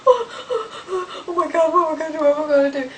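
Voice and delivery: high whimper with a laugh breaking through; half-laughing panic, unsure what to do; panicked whimpering. Retold or imitated. Retelling her fright in bed to viewers, laughing panic.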